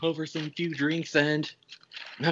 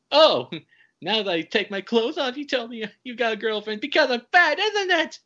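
higher and louder, the girlfriend playing hurt and accusing; mock offended outburst; 10 semitones higher, 4 dB louder than usual. Woman accusing Dave of calling her fat, mock offended outburst. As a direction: play the insulted woman, half laughing. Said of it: "And she's like".

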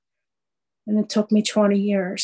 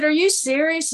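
high-pitched, incredulous question; surprised disbelief; 8 semitones higher than usual. Retold or imitated. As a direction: ask it as if you can't believe the news. Stunned by the Hollywood phone call.

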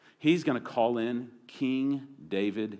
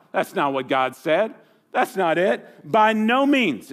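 emphatic denial; 7 semitones higher, 5 dB louder than usual. Pretend. Preacher building emphatic denial.

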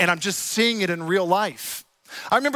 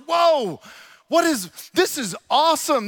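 high, bright burst of wonder on "Whoa"; retold amazement, pitched up; amazed whoa; 6 semitones higher than usual. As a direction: gasp it out like seeing a miracle. Amazed at healings in church.

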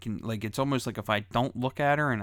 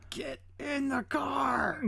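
high, drawn-out strained holler, held back in volume; acted parental yell; 5 dB quieter, much slower than usual. Dad yelling at his kids before the neighbors, stretched-out holler. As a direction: mock-scream it slowly at the kids, high and strained. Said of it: "I curse at 'em loudly".

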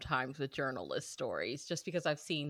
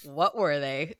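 laughing; 3 dB louder, slower than usual. Real, not acted. Laughing question.

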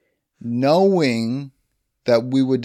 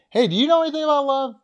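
much higher, fast mock voice; mocking cop; 13 semitones higher, 3 dB louder, much faster than usual. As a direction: fire it off in a squeaky mock tone. Cop mocks suspect.

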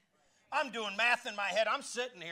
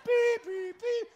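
mock beeping; 6 dB louder, much slower than usual. Imitating the payment machine.

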